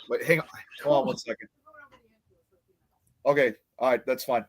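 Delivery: speaking away from the mic; turning away from phone. Real, not acted. Aside to mom mid-show.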